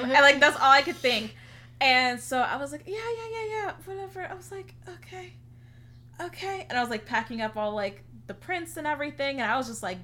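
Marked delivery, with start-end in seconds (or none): 2.9-6.7 much higher, brushing-off yeahs and okays; dismissive. Retold or imitated. Brushing off the best friend, high and dismissive. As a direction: fob her off in a high breezy voice.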